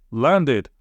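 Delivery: ordinary delivery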